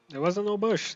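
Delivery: loud and high, protesting against the co-driver call; defensive outburst. Real, not acted. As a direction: shout back that it was not a bush. Arguing with the game's co-driver call.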